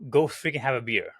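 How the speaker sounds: ordinary delivery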